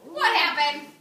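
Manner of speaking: eager loud question; loud prompt. Real, not acted. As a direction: call it out to the room. Teacher prompts class loudly.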